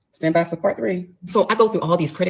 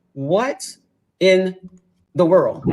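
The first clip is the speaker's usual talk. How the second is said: each word set apart by pauses; stunned disbelief; 3 semitones higher, much slower than usual. Retold or imitated. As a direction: spell out your disbelief word by word. Stunned at the bills.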